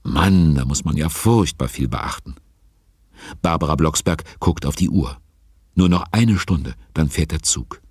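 ordinary talk

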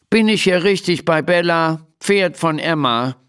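grumpy. A narrator's reading. Little stranger at the stable door seeks Bella, mildly grumpy. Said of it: "fragt jemand in verdrießlichem Ton".

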